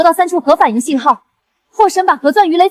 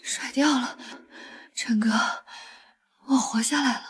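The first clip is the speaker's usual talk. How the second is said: breathless whisper; 4 semitones lower, 17 dB quieter than usual. Acted. Relief after escaping sharks.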